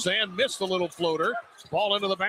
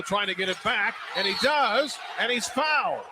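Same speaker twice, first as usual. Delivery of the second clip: excited call; 4 semitones higher than usual. Real, not acted. Scramble for loose ball, foul.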